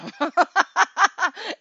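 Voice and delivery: big high burst of laughter; loud laugh. Real, not acted. Recalling the struggle, a loud laugh.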